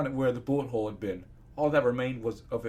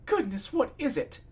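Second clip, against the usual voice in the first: high-pitched, worried pony voice; alarmed Rarity; 11 semitones higher than usual. Acted. Rarity reacts to the injured stranger, high alarmed voice. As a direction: voice her far higher, fretting.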